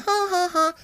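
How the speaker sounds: high breathy dazed panting; mock swooning. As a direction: gawk like someone lovestruck. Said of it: "be like".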